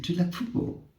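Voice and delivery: put-on a touch of the patient's African accent on "football"; mild African accent. Patient asking a third time, mild African accent. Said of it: "his accent was quite African".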